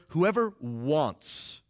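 "wants" stretched out for emphasis; stress on WANTS. Shown as a demonstration. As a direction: draw out the word wants slowly. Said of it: "really emphasize the word wants".

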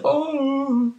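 high startled oh; shocked gasp. Retold or imitated. Gohan seeing something shocking, high startled oh.

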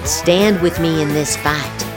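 ordinary delivery

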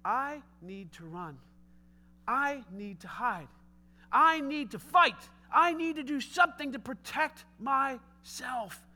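slow, each I need to pressed out; fearful mantra. Retold or imitated. A fearful believer repeating a mantra, slow. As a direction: chant it slowly like an anxious loop. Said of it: "a mantra like".

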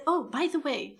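lightly imitating the boss's breezy aside; imitating her casual aside. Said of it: "like this, I still remember how".

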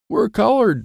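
high alarmed cry; yell. Acted. Dwayne yells that paint covers them. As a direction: shout it out in alarm. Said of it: "Dwayne yells".

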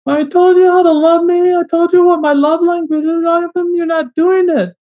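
high, whiny complaining voice. Retold or imitated. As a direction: whine it like a needy partner. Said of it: "You get this little whiny voice that says".

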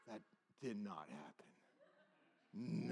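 hushed, drawn-out disbelief; very soft and low, each word dragged out; stunned disbelief. Retold or imitated. Teller reacting to the classmate, hushed disbelief.

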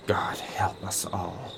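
sigh, then breathe the words almost unvoiced; a hushed, weary prayer; sighed whisper. Lindvig fearing the communists, sighing it out in a whisper.

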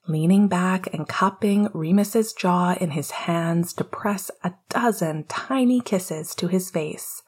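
plain reading voice

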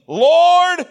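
high, loud, sustained call; acted calling out. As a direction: cry it out from the verse, held long. Reading the woman's cry to Jesus.

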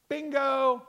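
high, loud "Bingo!" like a game-show win; excited shout. Real, not acted. Preacher cheering Peter's confession to the congregation, loud excited shout.

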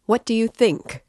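narrated shout, only moderately loud; mild excited shout. A narrator's reading. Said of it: "shouted Razumihin, louder than ever".

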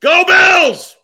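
huge stadium yell of Go Bills; shouting. Shown as a demonstration. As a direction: scream it from the stands.

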